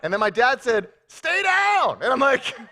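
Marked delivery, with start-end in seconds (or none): 1.1-2.0 loud, high-pitched retold order; mock dad's shout. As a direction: shout it like a dad from the sideline. Retold dad's order to injured son.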